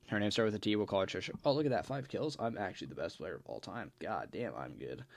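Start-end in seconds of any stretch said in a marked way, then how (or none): 1.5-5.0 muttered under the breath, much softer, to himself mid-game; quiet gaming aside. Real, not acted. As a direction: drop to a low mumble while you play. Muttering while playing a game.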